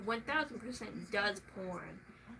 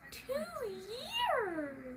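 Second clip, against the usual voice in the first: sharp gasp, then much higher outburst; mock gasp; 10 semitones higher than usual. Real, not acted. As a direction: gasp in fake shock at the answer. Mock outrage at two years.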